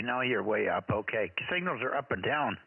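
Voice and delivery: usual speaking voice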